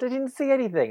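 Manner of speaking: baffled protest; disbelief. Retold or imitated. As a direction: insist in disbelief. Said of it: "I was like".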